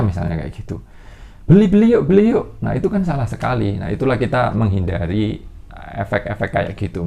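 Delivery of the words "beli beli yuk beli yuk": high-pitched, louder mock sales push; eager urging. Promoter urging people to buy the stock, eager and high-pitched. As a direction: egg them on in a mock high voice.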